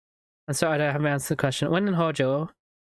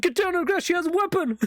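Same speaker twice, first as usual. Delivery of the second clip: much higher mock shout; raiding agent. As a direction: warn the squad, cartoonishly. Mock FBI raid on granny.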